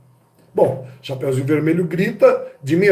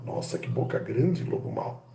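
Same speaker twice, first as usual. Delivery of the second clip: much softer, low seductive murmur; suggestive; 6 semitones lower, 11 dB quieter than usual. Shown as a demonstration. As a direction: breathe it as a double meaning. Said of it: "Será que boca é boca?".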